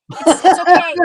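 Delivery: put-on high, loud sugary little-girl voice; imitating a daughter playing innocent and sweet. Mother mocking her daughter's fake sweetness, high and sugary. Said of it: "she's trying to play the sweet".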